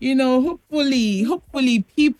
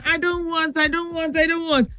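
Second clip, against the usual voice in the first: higher, softer mock whine; imitated crying child; 5 semitones higher, 4 dB quieter than usual. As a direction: mimic a child fussily refusing. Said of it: "the child was crying".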